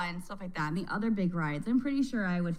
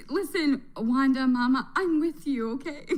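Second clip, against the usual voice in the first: louder, earnest call of support; emphatic call; 5 semitones higher, 6 dB louder than usual. Real, not acted. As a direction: call out to her in solidarity. Calling out to creator sympathetically.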